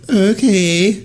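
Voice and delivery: high, breathless rising okay; swooning okay. Retold or imitated. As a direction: melt at the offer. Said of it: "You're like".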